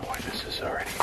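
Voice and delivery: nervous whisper. Real, not acted. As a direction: hush it, spooked. Nervous inside creepy house.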